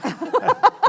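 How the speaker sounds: high, bursting laugh of joy; delighted laugh. Retold or imitated. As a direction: laugh out in disbelief. Joyful laugh at job offer.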